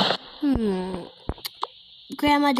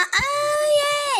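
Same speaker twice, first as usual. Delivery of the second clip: sudden squeal, much higher; excited high squeal. Acted. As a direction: squeal out in protest. Kid protests, bursts out.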